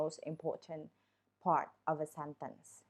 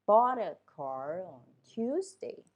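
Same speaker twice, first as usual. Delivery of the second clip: "Bought a car on Tuesday" with "Bought", "car" and "Tuesday" louder, the rest light; slow with content stress; 7 dB louder, slower than usual. Shown as a demonstration. Teacher demonstrating stress on "Bought", "car", "Tuesday", slowly. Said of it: "You read it louder".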